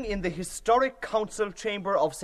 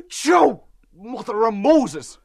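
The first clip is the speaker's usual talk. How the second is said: exclaiming; 3 semitones higher, 8 dB louder, much slower than usual. Acted. Building to a sneeze.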